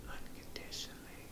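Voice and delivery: a bare unvoiced whisper on "Unconditionally". First time daring to claim God's love, a whisper. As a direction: breathe "Unconditionally" out with no voice at all. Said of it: "I whispered it".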